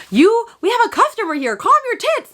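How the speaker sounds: high, squeaky mock scold; shrill-pitched hammed-up telling off of her sister. Author sister scolding her sibling in front of a customer, high mock voice. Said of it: "the other sister is just like".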